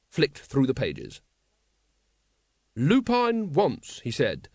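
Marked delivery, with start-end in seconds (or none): 2.7-4.0 high, slow, formal name-reading; official reading. Acted. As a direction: announce the name like an earnest constable. Carrot formally reads the charge.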